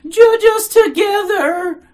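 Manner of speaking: very high, loud, drawn-out wail; frenzied exclamation. A narrator's reading. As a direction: plead at full frenzy. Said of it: "exclaiming frenziedly".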